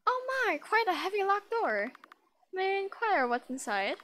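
put-on high, loud and refined, lightly accented; posh character accent. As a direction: play the genteel visitor with a put-on accent. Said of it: "where's my accent?".

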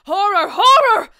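horror mounting with each repeat, break into a scream; rising shriek of horror; cry of horror. Acted. Discovering the murdered king.

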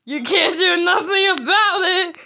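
high, loud gloating laugh through the words; laughing taunt. Real, not acted. Player gloating at annoyed players, laughing taunt. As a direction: cackle at them as you taunt.

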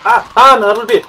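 loud, high burst of fright; startled yelp. Real, not acted. Player as a zombie grabs the foot, startled yelp. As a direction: yelp and blurt it out in alarm.

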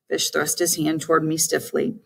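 plain reading voice

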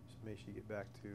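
earnest spoken prayer, soft and halting. Real, not acted. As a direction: pray it quietly, pausing between names. Closing prayer before Bible study.

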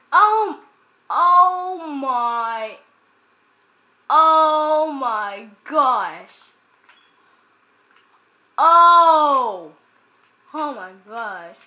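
loud, slow, falling groan of dismay; slow loud dismayed exclamation; drawn-out exasperated oh my gosh. Real, not acted. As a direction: stretch it out, fed up. Attacked by mob.

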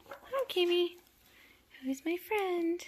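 high, drawn-out cooing to a kitten; pet talk. Real, not acted. Greeting Kimmy the kitten, high pet talk.

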